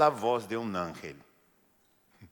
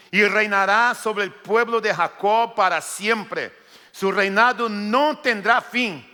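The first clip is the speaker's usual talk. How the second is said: raised, higher storytelling preach; fervent preaching; 7 semitones higher, 7 dB louder, slower than usual. Acted. Preacher to congregation, fervent preaching. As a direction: drive the story home to the church. Proclaims the angel's prophecy.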